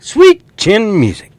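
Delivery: high, loud, slow catchphrase; hyping the crowd with a wrestling catchphrase; wrestling hype. Pretend. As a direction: belt it like an arena announcer.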